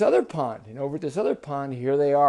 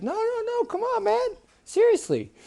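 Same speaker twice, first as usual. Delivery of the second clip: very high-pitched protest; squeaky, urgent pleading; insistent frog princess. Acted. Frog princess convincing doubtful boy, insistent.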